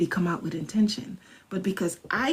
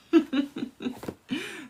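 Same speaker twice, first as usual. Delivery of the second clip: laugh; 8 semitones higher, 4 dB quieter than usual. Real, not acted. Laughs at own joke.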